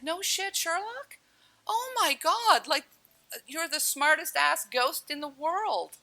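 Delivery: very high-pitched mocking exclamation; sarcastic retort. Drowning woman mocking the ghost's obvious warning, sarcastic.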